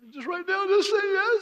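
urgent pleading cry. Retold or imitated. Youth leader crying out to the kneeling boy, pleading loudly. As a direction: beg him to decide right now.

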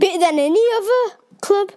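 high, quick muddled Geordie; unplaceable attempted Geordie accent. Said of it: "I'm not sure what that accent is".

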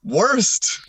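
"Worst?" asked with a high, rising tune; incredulous "worst?". Real, not acted. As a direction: echo the word in disbelief, pitched high. Outraged echo of the question.

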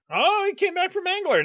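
real happy surprise; pleasant surprise. Real, not acted. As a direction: react with a high, drawn "oh".

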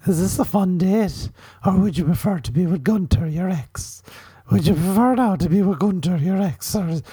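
high, silly put-on voice; new dating voice. Dude asking his date if she's having fun, high dating voice. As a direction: croon the questions in a high comic voice. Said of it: "This is my new dating voice".

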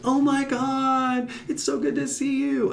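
mock the fake delight of an old acquaintance; gushing greeter. Imitating a man who pushed her, gushing greeting.

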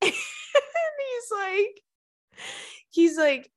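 high laugh through the words. Real, not acted. Laughing while telling.

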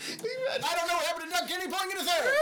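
race caller. Pretend. Mock horse race call.

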